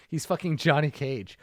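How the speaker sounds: usual speaking voice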